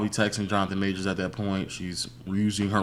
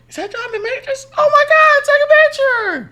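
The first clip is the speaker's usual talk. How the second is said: very high-pitched, loud mock fan squeal; excited fan; 9 dB louder than usual. Podcaster imitates fans spotting Jonathan Majors, high excited squealing. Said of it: "Some fans said".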